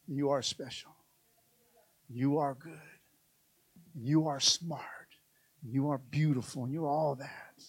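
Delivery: hushed, whispered blessings; soft whisper of affirmation. Acted. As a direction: whisper love over a child. God speaking softly over you.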